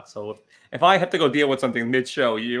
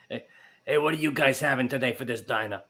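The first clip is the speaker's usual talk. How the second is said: diner waitress; 2 semitones lower than usual. Pretend. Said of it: "you're going with, uh, Doris".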